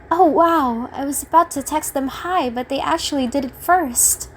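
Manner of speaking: imagine a happy surprise, voice lifting; joyful surprise. Pretend. Imagining they would message first for once, joyfully surprised. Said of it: "I want to feel that joy of".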